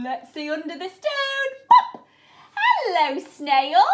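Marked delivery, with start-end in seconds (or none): 1.7-2.1 high, loud popping sound; loud pop. Acted. Teacher lifting a flap for the children with a high loud pop. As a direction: pop it out sharply.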